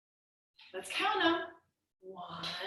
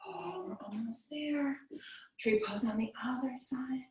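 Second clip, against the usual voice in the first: whisper; 8 dB quieter than usual. Real, not acted. Calming yoga end.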